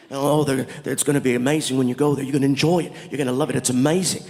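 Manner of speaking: deep, enthusiastic impression of an Indian man; Indian man's voice. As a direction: imitate an Indian man with an unexpectedly low voice. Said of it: "your voice doesn't match the".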